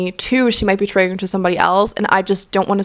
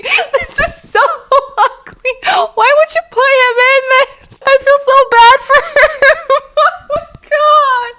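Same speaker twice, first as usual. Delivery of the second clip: much higher, laughing near tears; crying laughing; 4 dB louder than usual. Real, not acted. Mocking printed belts, crying with laughter. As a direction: squeal through tears of laughter. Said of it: "I'm tearing up".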